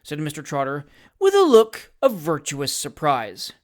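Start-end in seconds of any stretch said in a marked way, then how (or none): none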